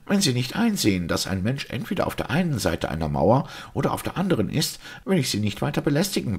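high, quickening impatient argument; annoyed. A narrator's reading. Simon threatens to leave the priest be, annoyed. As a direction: press the point irritably, speeding up at high pitch. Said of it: "bemerkte er ärgerlich".

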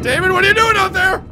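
high, loud cry of alarm; alarmed shout. Real, not acted. Player seeing David out there, alarmed shout. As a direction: yell it out high to someone far out.

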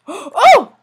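high loud gasp of oh; startled shout. Real, not acted. Zombie surprises him, startled loud oh. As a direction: jump with fright. Sudden fright.

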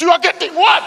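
high, rising indignant question; getting angry. Acted. Auditioner challenging the puzzled judges, high acted indignant anger. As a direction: throw it back as outraged mock disbelief. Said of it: "Now he started getting angry".

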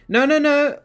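high, loud rush of denial; urgent protest. Real, not acted. Streamer denying they ignored a viewer, urgent protest. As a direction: cut in loudly, pitched right up.

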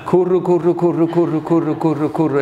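repeating kurro kurro in a low murmur; babbling kurro. Retold or imitated. Preacher imitating his only tongue sound, kurro. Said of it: "en hel månad".

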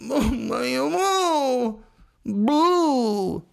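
high, mocking sob-story voice; hammed-up weepy addict; mock whiny addict. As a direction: whine it like a pitiful addict.

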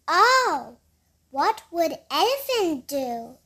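ordinary delivery

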